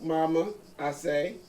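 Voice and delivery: plain reading voice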